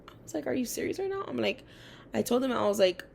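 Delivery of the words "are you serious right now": incredulous. Retold or imitated. Disbelief at teacher's accusation.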